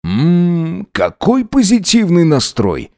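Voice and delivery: high, slow mock admiration; amused, drawn-out sarcastic drawl; snorting sarcasm. A narrator's reading. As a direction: draw it out, teasing, pitch lifted. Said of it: "фыркнул Максимилиан".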